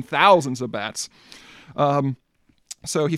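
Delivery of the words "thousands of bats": slow, weighty thousands of bats; spooky slow emphasis; slow emphatic. Acted. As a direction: lean on thousands.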